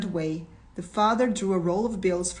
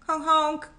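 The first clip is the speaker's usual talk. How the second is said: high, ringing "honk honk"; honking like a car horn, pitched well up; imitated car horn; 9 semitones higher, 4 dB louder than usual. A narrator's reading. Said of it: "Hoarse and sharp, the cry of a motor".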